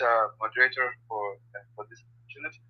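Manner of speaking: faint voice, far from the mic; fading away from the microphone; barely audible. Real, not acted. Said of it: "'cause your voice is very low".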